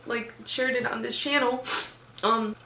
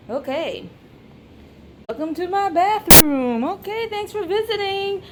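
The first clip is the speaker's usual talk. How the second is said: high sing-song voice for a pet; talking to a dog; 6 semitones higher than usual. Acted. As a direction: coo at the dog like a baby.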